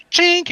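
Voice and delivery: high, loud hook-catching sound effect. Acted. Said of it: "you're like".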